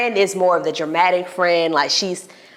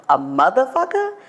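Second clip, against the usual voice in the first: high, scandalised question; shocked pearl-clutching; 6 semitones higher than usual. Retold or imitated. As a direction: gasp at the word like a shocked lady. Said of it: "clutches pearls".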